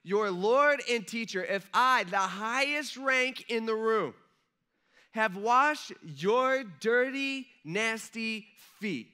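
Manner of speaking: loud, slow, high-pitched emphasis; emphatic preaching. Real, not acted. Preacher pressing the point on his listeners, loud and slow. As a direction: preach it loud and slow, leaning on each word. Preacher stressing Jesus washing feet.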